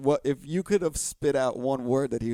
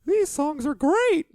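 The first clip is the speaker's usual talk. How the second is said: put-on high, delighted childlike voice, very slow; Frank impression; 6 dB louder, much slower than usual. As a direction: gush like a wide-eyed cartoon. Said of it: "That's where he's like".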